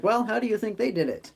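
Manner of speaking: high-pitched gnome posing a question. Acted. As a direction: lift it high, a little gnome quizzing him. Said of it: "And he's like".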